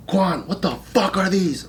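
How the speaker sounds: a hint of mock interrogation; stern instructor. Drill instructors find contacts in the mail, lightly imitated demand. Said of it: "and they're like".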